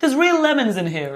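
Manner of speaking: high, upbeat advert chef voice; bright advert pitch. Retold or imitated. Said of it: "he grabbed a lemon and went".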